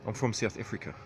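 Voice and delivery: put-on softly tried South African accent; attempted South African accent. Introducing himself as a local, soft mock South African accent. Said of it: "So what's South African?".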